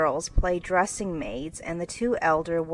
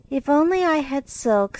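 sigh; 8 semitones higher, 5 dB louder than usual. Acted. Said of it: "sighed Meg".

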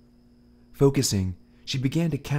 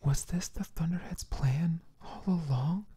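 soft, slow whisper to herself; muttered whisper; 7 semitones higher, 6 dB quieter than usual. Acted. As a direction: breathe it out in disbelief. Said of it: "she muttered".

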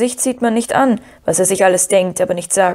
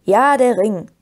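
firm answer, not a question; matter-of-fact confirmation; slower than usual. A narrator's reading. Merry confirms to stunned Frodo that he knows, matter-of-fact. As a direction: confirm it knowingly.

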